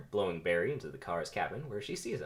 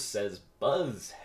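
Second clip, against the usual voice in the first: usual speaking voice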